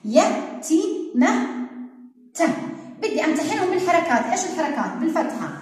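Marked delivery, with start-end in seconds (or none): 0.0-1.5 يتيمة stretched extremely slowly, syllable by syllable; very slow sounding out. Shown as a demonstration. Teacher to first grade, testing the word slowly. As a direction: draw يتيمة out as if spelling it aloud. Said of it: "بدي أنا أمتحنهم".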